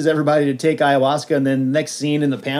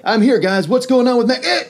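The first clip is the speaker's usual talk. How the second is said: much higher, breezy hero arrival; cocky Iron Man; 9 semitones higher, 5 dB louder than usual. Retold or imitated. Hero arrives at the fight, breezy and cocky. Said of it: "he's like".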